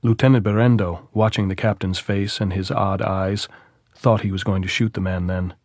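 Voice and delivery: ordinary delivery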